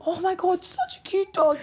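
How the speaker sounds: high, gushing coo; cooing at a dog. Retold or imitated. Friend gushing at a tied-up dog, high coo. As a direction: melt over the cute little dog.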